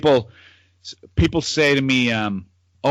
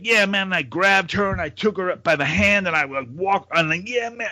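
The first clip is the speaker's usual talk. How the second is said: American street bum; 6 semitones higher than usual. Retold or imitated. Bum telling TV interviewer of the rescue, mock American accent. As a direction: play an American bum telling his story. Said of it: "a bum on the street".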